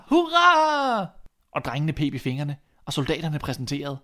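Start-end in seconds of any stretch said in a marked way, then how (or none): 0.1-1.1 high, loud, drawn-out cheer; shouted hurrah. A narrator's reading. The dogs cheer again for the new king, a long loud high shout. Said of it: "dansede foran og råbte".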